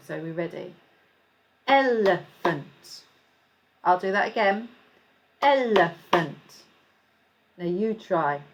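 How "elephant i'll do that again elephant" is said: slow Elephant, el-e-phant in three even beats; slow clapped syllables. Shown as a demonstration. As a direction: stretch Elephant out beat by beat. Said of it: "I'm going to clap it".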